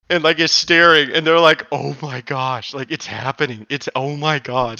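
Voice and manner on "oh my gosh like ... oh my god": hushed onlookers. Retold or imitated. Onlookers gasp as she sits.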